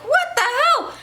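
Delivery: very high, startled what the hell; shocked. Retold or imitated. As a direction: squeak it out in disbelief. Recognizing the girl at the door.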